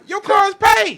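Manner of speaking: loud, high burst of excitement; emphatic shout. Real, not acted. Friend hyping up paying off the car, loud and high. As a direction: shout it like the debt's gone. Excited claim about lottery payoff.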